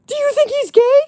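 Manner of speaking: high, loud suspicious question; imitating friend. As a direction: squeak it out like the friend. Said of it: "She's like".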